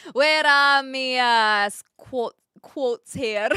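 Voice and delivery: drawn-out, hammed-up Scottish accent; amateur Scottish accent. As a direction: drag it out in mock Scots. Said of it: "my best attempt at a Scottish accent".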